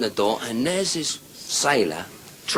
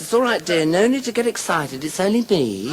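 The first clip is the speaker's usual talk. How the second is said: camp reassurance; 7 semitones higher than usual. Acted. Effeminate man soothes himself.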